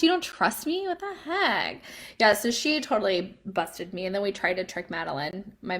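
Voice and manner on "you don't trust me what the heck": high-pitched mock indignation; playful, squeaky-high disbelief; mock offended. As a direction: act hurt and scandalized. Mock offence at suspicious daughter.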